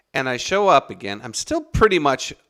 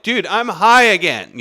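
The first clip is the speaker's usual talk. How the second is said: higher, louder mock exclamation; retold alarmed realisation; 8 semitones higher, 7 dB louder than usual. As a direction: blurt it out, reliving the surprise. Retold sudden realisation of being high.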